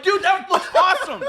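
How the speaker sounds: put-on high, laughing praise to a kid; excited hype. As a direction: pump the kid up, bright and loud. Hyping up a kid.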